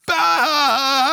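loud, high mock cackle; long put-on burst of laughing; imitated laughter. Guests laughing at the panicked host, hammed-up guffaw.

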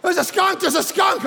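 high, loud frantic repeat; panicked. Retold or imitated. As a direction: blurt it out in alarm. Daughter panicking.

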